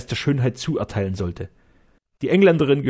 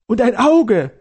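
much higher, louder swooning cry; rapturous; 11 semitones higher, 7 dB louder, slower than usual. Acted. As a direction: swoon over her eyes. Castellan raves about her.

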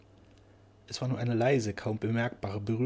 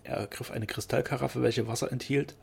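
plain reading voice

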